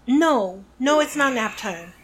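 ordinary delivery

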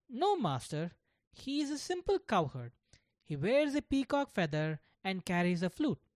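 a hint of an earnest little boy speaking up; a child's voice. Gopal answers his teacher.

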